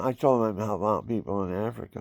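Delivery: normal talking voice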